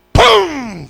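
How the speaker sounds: explosive loud, high "pum"; sudden booming sound effect; imitated bang. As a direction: burst it out like a bang. Imitates the lights suddenly coming on.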